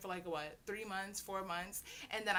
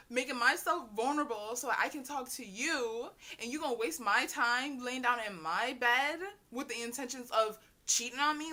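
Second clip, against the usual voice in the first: angry rant; 3 semitones higher, 8 dB louder than usual. Retold or imitated. Telling off the cheating boy, louder and indignant. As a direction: scold him for wasting her effort.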